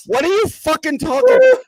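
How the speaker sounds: high-pitched mock outrage; Ryan. Imitating co-host's off-air outburst, incredulous yell. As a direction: yell it in hammed-up disbelief. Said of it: "Ryan foaming at the mouth".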